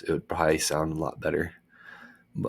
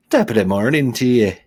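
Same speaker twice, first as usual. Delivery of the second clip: put-on Irish accent; 5 semitones higher, 10 dB louder than usual. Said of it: "kinda try to talk like an Irish".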